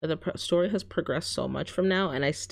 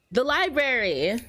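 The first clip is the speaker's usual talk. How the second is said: excited realization; 6 semitones higher than usual. Real, not acted. Sudden realization of answer.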